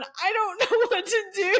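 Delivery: high and laughing through it; young teacher stuck with the angry secretary, laughing; laughing helplessness. Retold or imitated. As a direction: giggle out the helpless words.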